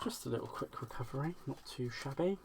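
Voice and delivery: ordinary talk, nothing marked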